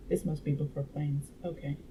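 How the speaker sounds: ordinary delivery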